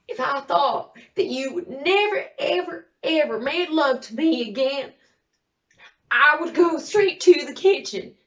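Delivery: loud, high, impassioned outburst; desperate threat. Acted. Desperate threat to her husband.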